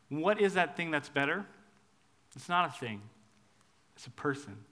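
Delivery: ordinary talk, nothing marked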